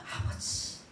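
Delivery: breathy whispered call to Father; hushed whispered prayer; soft whisper. Shown as a demonstration. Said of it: "이것만 하면 돼요".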